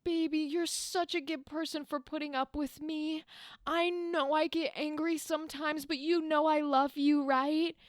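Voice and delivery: hammed-up, pleading lovey-dovey voice; toxic ex. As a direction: gush like a manipulative ex making up. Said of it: "when the toxic ex goes".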